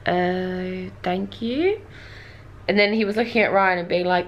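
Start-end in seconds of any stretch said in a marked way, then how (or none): 0.1-1.8 hesitant, rising to a question; drawn-out, unsure politeness; awkward thanks. Retold or imitated. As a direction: thank them very uncertainly. Awkward reply to dubious compliment.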